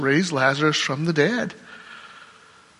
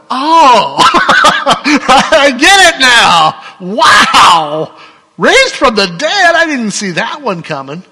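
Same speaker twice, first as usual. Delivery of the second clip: laughing, shouting, much higher and louder; excited realization; 9 semitones higher, 11 dB louder, much slower than usual. Acted. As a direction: burst out with joyful, laughing amazement. Stunned disciples suddenly understand.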